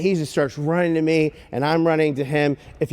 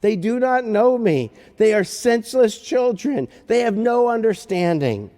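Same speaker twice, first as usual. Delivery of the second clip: high, slow, emphatic declamation; lamenting God; 7 semitones higher, slower than usual. Acted. God lamenting over foolish people, raised declaiming voice. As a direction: pronounce it as a pained, ringing indictment. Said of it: "He says, he says".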